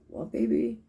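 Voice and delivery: put-on high, coaxing voice to a toddler; soothing aunt. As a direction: ask the little one gently. Aunt answering small child.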